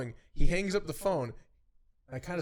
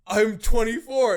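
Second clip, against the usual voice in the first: loud, higher, drawn-out reply; raised-voice answer; 7 semitones higher, 13 dB louder, slower than usual. Retold or imitated. Student giving his age to the boss, loudly.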